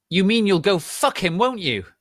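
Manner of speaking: loud, high accusing yell; jealous angry shout. A narrator's reading. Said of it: "I shouted angrily".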